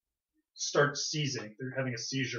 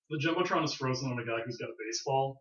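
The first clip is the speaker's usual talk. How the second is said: normal talking voice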